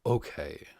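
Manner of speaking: usual speaking voice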